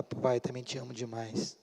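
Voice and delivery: soft, low affectionate reply; tender. Retold or imitated. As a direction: murmur it lovingly, as the son replying. Filho retribui o amor. (resposta baixinha e carinhosa)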